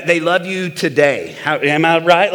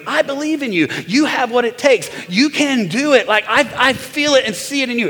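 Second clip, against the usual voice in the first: cheerleader wife; 7 semitones higher than usual. Retold or imitated. Wife cheering him on, voiced.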